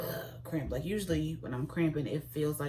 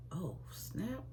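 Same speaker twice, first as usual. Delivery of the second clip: whispered oh snap; 3 semitones higher, 6 dB quieter than usual. Retold or imitated. Realizing she is pregnant.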